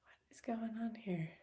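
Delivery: hushed whisper to herself. Real, not acted. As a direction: whisper it under the breath, slowly. Muttering at a glitching program.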